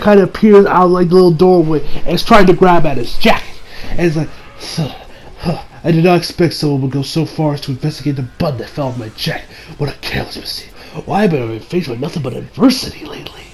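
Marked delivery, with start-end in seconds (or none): 5.4-13.4 hushed, sinister whisper to himself; low muttered whisper; whispering villain. Acted.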